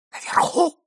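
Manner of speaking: breathless whispered question. A narrator's reading. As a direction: hiss it under your breath in alarm. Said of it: "прошептал молодой человек".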